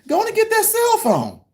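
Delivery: dad teasing his son in a high mocking voice; mock taunting. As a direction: needle the son with fake encouragement. Taunting son about the phone.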